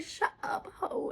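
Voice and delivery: hissed under the breath; whispered scolding. Retold or imitated. Looking back at herself, whispered scolding. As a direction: scold her past self in a whisper. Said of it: "And I'm like".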